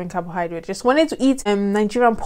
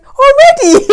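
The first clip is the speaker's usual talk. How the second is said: high, loud laughing "already"; husband's stunned "already", laughing high and loud; laughing disbelief; 11 dB louder, slower than usual. Retold or imitated. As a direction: burst out laughing in surprise. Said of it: "And he was like".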